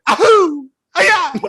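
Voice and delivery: high, loud cartoon yelps; mock Italian yelp. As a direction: squeal it like a goofy video game hero. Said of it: "he almost was like".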